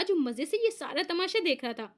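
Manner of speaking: ordinary delivery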